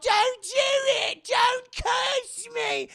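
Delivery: shrieking high and loud, drawn out; panicked scream. Retold or imitated. Anna screaming at her sister not to curse her.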